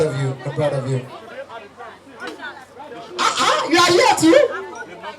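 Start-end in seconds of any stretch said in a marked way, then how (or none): 3.2-5.2 high, slow, laughing exclamation of surprise; mock surprise. Comedian spots a guest in the crowd, hammed-up surprise. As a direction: drag it out like spotting a star.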